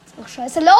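loud alarmed cry; panicking gamer. Real, not acted. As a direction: yell out in panic. Low health in game panic.

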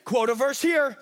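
ordinary delivery

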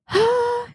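high, overwhelmed sigh; emotional sigh. Real, not acted. Fan overwhelmed that [name] will own her work, sighing. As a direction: let out a big emotional sigh.

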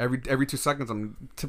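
usual speaking voice

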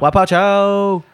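loud, high, drawn-out call; segment call-out. Pretend. Co-host announces the next segment, drawn-out high shout. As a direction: belt it out slowly like a show jingle.

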